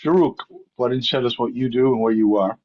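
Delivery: normal talking voice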